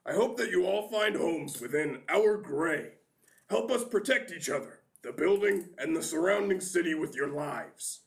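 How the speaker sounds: grand welcoming address; formal principal speech. Acted. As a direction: address the new class proudly.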